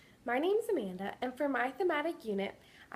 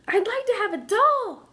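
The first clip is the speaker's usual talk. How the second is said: put-on high, louder tearful child's plea; sobbing little girl; 10 semitones higher, 11 dB louder than usual. Hurt girl pleading with her teacher, high sobbing child voice. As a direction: voice a small girl begging through sobs. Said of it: "sobbed Amelia".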